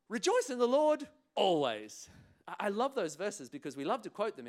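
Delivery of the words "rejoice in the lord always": high, loud declaration falling on "always"; quoting scripture. Shown as a demonstration. Preacher quoting a verse to church, declaimed with falling "always". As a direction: proclaim it through to a firm "always". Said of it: "a little bit like quoting scripture".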